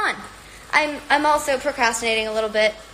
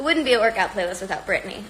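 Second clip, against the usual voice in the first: ordinary talk, nothing marked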